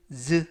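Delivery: "즈" as a buzzing voiced z; voiced z sound. Shown as a demonstration. Teacher showing the voiced S to students. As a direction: buzz the "즈" clearly. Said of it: "S는 스가 아니라 즈".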